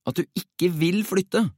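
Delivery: high, insistent follow-up question; urgent pleading. A narrator's reading. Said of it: "prøvde Jakob inntrengende". (inntrengende, høyt i tonen)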